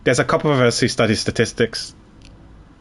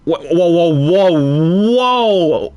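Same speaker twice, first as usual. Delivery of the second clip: higher, louder stretched whoas; real astonishment; 5 semitones higher, 4 dB louder, much slower than usual. Real, not acted. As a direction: react in disbelief, building up. Shocked reaction to game twist.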